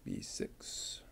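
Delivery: breathy whisper, repeating the part number under the breath; murmured check of B six; whispered to self. Real, not acted. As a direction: whisper it to yourself while checking.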